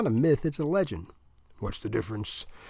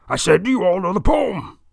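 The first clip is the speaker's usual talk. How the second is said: loud, raised bark of a Marine drill instructor; drill instructor shout; 6 semitones higher, 9 dB louder than usual. A narrator's reading. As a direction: demand it at full volume, higher and harder. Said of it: "sounds like a Marine drill instructor".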